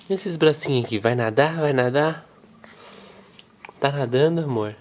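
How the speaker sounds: low, gentle cooing. Real, not acted. Father talking to baby.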